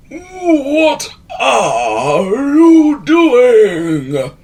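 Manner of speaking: high, loud, very slow wheezing question; wheezy wizard. A narrator's reading. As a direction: stretch it out like an old wheezing wizard. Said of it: "wheezed Wizard Wheeze".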